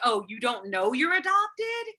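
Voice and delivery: usual speaking voice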